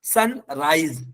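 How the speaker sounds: "सनराइज" with a final Z. Shown as a demonstration. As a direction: buzz the end of "सनराइज": "sun-raiz". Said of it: "एस की आवाज जा से आएगी". ("सनराइज" में ज़ा की आवाज़)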